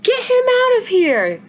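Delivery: high, loud panicked shriek; alarmed high-pitched yell. Acted. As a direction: scream to get the dog away. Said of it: "She shrieks".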